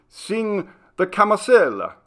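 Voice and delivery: high, loud foreign voice demanding a song; foreign tourist ordering. A narrator's reading. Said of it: "ordered some voice in a foreign accent".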